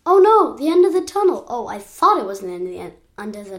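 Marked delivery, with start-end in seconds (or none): 0.0-1.5 high, loud cry of dismay; sudden loud, high alarm. A narrator's reading. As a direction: exclaim it as if hitting a dead end. Narrator hits a dead end.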